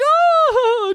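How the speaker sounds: loud high-pitched fake sob; hammed-up wail; mock crying wail. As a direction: burst into exaggerated bawling. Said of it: "he just starts crying as he's talking".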